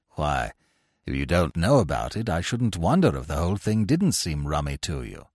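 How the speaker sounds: low, mild upper-class chap; apologetic young chappie, sheepish. A narrator's reading.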